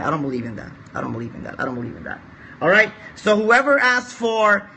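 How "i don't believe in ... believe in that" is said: low, soft repeated mutter; quiet doubtful muttering, lower and softer; muttered aside. Real, not acted. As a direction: say it under your breath to yourself.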